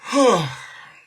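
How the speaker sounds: loud, heavy exhale; loud sigh. Real, not acted. Streamer catching herself overthinking, loud sigh. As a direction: sigh it out hard.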